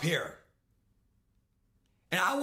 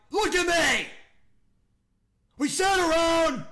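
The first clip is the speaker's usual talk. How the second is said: heated shout; 6 semitones higher, 5 dB louder than usual. Real, not acted. Ranting at his own team.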